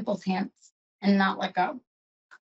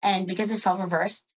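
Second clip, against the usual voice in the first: ordinary delivery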